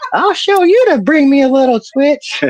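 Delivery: high, screechy granny threat; hammed-up vengeful granny; mean old grandma. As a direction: scold it in a high old-lady voice. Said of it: "she used to be a mean old lady".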